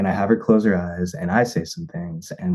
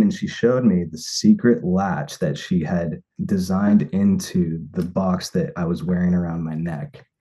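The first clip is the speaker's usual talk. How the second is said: ordinary talk, nothing marked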